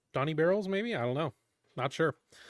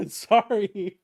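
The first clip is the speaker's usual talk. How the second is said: laughing apology; 5 semitones higher than usual. Real, not acted. Laughing after the screaming.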